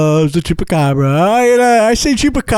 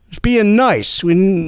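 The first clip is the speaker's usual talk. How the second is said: ordinary talk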